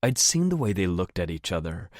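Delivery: ordinary delivery, nothing marked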